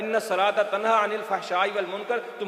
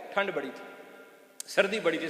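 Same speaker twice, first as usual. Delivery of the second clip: ordinary talk, nothing marked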